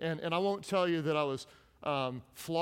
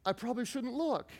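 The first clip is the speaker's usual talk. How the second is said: put-on sheepish kid; 7 semitones higher than usual. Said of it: "So what should you do".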